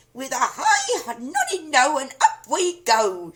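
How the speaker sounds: put-on high, loud, slow screechy old woman chant; crone shout. A narrator's reading. Grandma growing wildly, screechy shout. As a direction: shriek it like a mad old hag. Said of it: "she shouted".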